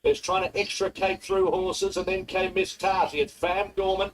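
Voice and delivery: rising race call, building excitement; race caller. Real, not acted. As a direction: call the finish as it heats up.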